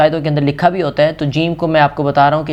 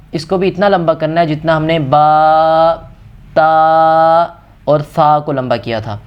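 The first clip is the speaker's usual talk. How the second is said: ordinary talk, nothing marked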